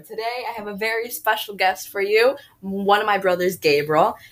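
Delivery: loud, hyped-up show host; excited announcer. Real, not acted. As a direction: build hype for the surprise guest. Loud hype announcement of guest.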